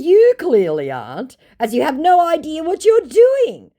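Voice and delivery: high, loud scornful put-down; mocking high-pitched scolding; sassy retort. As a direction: slap him down with scorn. Her sassy retort to the scammer.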